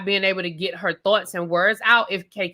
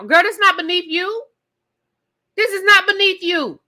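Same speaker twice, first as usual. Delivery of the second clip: higher, stress on "not"; emphatic scolding; 7 semitones higher than usual. Real, not acted. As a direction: insist hard on "not beneath you". Scolding K K's attitude.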